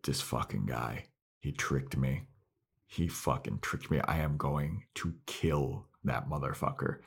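low, quiet seething fury; hushed, low-voiced rage; seething Willow. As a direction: mutter the threat under your breath, low and hushed. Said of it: "Willow's super pissed".

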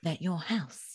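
a hint of Kim telling the owner the bad news; impression of Kim. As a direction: break it gently like a posh TV cleaner. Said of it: "And it'd be Kim".

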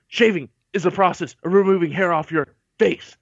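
lightly imitated drill sergeant lecture. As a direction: recite it like a stern instructor. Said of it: "he goes".

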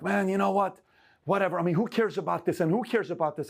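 dismissive scoff. Retold or imitated. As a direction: brush off the dream with a scoff. Imitating dismissive people.

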